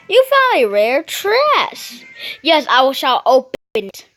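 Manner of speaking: hammed-up proud announcer; mock grand announcement. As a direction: announce the find like a herald. Reading the prize in a mock voice.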